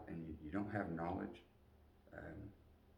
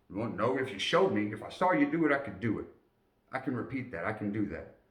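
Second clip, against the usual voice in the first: louder and higher, eager answer; eager reply; 4 semitones higher, 7 dB louder than usual. Retold or imitated. Private answering his sergeant about learning, eager and loud. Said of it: "private answering sergeant".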